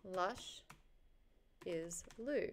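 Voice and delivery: "Lush" ... "is Lou", split by a long pause; sounding it out. Real, not acted. Streamer typing a name into search, "Lush is Lou" slowly sounded out. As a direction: say "Lush", wait, then softly "is Lou".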